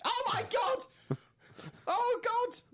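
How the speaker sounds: high, squealing hammed-up horror; mock horrified shock. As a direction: cry out as if shocked by search results, half laughing. Imagined unwitting web searchers, shocked.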